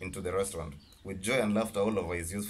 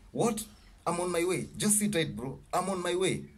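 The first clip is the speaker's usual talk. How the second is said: high, urgent shriek into the phone; panicked, pitch way up; shrieked alarm; 7 semitones higher than usual. Acted. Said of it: "shrieked out loud".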